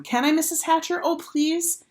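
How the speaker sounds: put-on high, pleading girl voice; begging. A narrator's reading. Sheila begging Mrs Hatcher to let her babysit, high pleading. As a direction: beg as sweetly as you can. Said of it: "begged some more".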